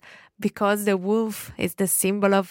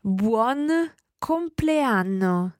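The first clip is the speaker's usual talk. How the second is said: very slowly, each word drawn out; slow demonstration; much slower than usual. Shown as a demonstration. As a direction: say it slowly for learners to repeat. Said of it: "Let's listen again to [name] say this slowly".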